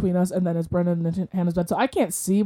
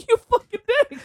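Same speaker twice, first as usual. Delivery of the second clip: high, laughing mock outrage; laughing insult; 13 semitones higher than usual. Telling off a grinning friend, laughing high insult. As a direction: curse him through a laugh.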